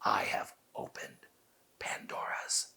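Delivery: slow, whispered I have opened Pandora's box; slow eerie whisper, as if peering into the box; vampire whisper. Shown as a demonstration. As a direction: look down your nose and breathe it like a vampire. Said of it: "Like you're looking into it".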